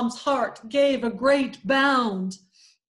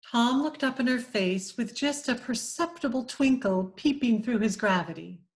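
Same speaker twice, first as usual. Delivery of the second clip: ordinary delivery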